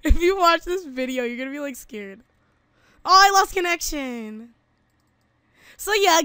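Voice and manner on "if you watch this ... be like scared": real laughter through the words; giggling hard at her own death in the game; laughing. Real, not acted. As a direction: laugh out loud while you speak. Laughing at her dead body.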